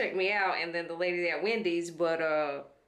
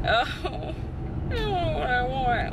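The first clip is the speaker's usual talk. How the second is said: whimpering; 7 semitones higher, slower than usual. Real, not acted. Hungry whining, near tears.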